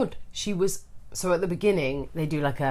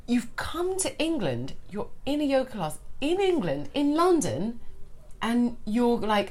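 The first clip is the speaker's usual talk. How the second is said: incredulous retort; 6 semitones higher, 4 dB quieter than usual. Retold or imitated. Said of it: "I was like".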